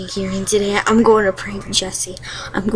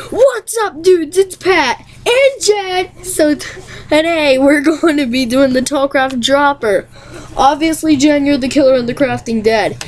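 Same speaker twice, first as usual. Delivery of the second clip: higher excited gamer host voice; bright upbeat YouTuber intro, pitched up; Pat impression; 4 semitones higher than usual. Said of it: "Okay, popular most".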